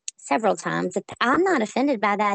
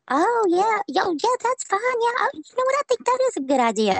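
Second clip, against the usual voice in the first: imitating agreeable judge; 13 semitones higher, faster than usual. Judges suddenly agreeing in court.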